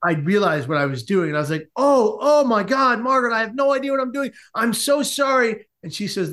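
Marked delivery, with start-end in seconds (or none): 1.7-5.7 high, flustered acted apology; panicked apology. Man realizing what he was doing to his date, hammed-up panic.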